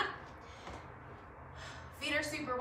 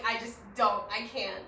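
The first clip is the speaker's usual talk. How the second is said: usual speaking voice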